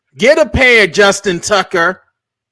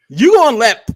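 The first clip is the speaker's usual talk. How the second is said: usual speaking voice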